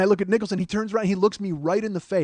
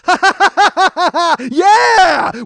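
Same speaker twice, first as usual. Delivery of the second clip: high, loud laugh bursting into "Yeah!"; triumphant whooping; 9 semitones higher, 9 dB louder, slower than usual. Retold or imitated. Brothers lock eyes mid-battle, triumphant shouted laughing. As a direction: cackle in triumph, then shout it.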